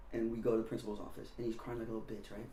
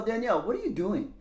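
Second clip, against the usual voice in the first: high, loud acted question; puzzled questioning; 9 semitones higher, 5 dB louder than usual. Older kid finding her on the skateboard, puzzled question.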